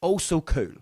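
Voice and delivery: ordinary talk, nothing marked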